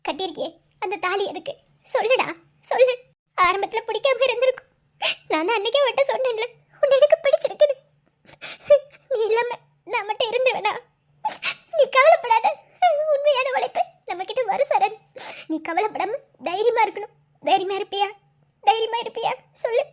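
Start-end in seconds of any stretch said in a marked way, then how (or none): 1.9-3.0 pressing demand. Acted. Wife demands an answer. 3.4-19.8 tearful reassurance. Acted. Wife tearfully reassures wounded husband.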